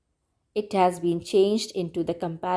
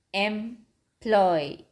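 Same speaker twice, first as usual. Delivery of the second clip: "employ" in two parts, em, ploy; syllable break. Shown as a demonstration. Teacher counting syllables for children, "employ" broken in two. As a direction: pause mid-word in "employ", each syllable drawn out. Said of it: "only two syllables".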